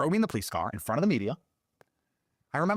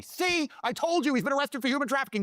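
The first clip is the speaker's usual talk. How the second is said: mocking gossip; 12 semitones higher than usual. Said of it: "About me".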